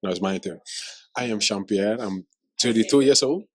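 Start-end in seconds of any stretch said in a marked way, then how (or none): none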